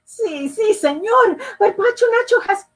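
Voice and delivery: high, fast pleading; boy pleading with the carpenter; eager child. Acted. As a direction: hurry to interrupt.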